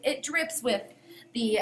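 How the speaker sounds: ordinary talk, nothing marked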